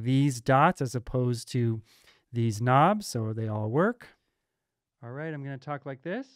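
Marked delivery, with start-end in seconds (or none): 5.0-6.3 quieter and faster, run through an EQ; EQ-filtered voice. Shown as a demonstration. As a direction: talk as the filter thins the sound out. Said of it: "dip to a little bit more bassy".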